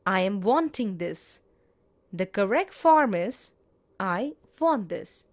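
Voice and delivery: ordinary talk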